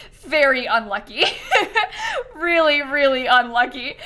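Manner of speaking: laughing through the words; cracking up at bad luck. Real, not acted. Laughing about the dead cat.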